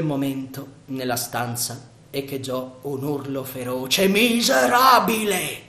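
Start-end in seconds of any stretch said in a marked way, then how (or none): 4.1-5.6 loud, slow, higher furious bellow; thundering roar. Acted. The lord catches Sandokan with Marianna, furious thundering shout. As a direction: thunder the insult like an enraged lord. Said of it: "Tuonò una voce".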